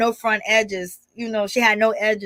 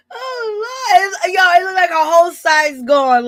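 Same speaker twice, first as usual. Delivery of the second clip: high, cracking laugh-cry; can barely get it out from laughing; 7 semitones higher, 3 dB louder than usual. Real, not acted. Laughing at bald hairline.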